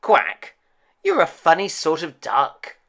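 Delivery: loud, quacking mother duck; a puzzled mother, quacking; head-shaking mother duck. A narrator's reading. Mother duck voiced in story.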